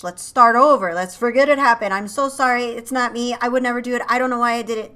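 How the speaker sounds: loud, begging apology in an ex's voice; loud pleading apology. Acted. The cheating partner begging forgiveness, loud and pleading. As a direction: plead to be taken back, full throated.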